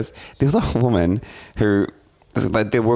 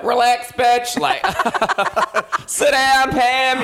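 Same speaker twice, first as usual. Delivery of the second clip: mock yell; much slower than usual. Telling off the woman in the cinema, hammed-up yell. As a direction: bark it at the stranger half in jest. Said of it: "I was like".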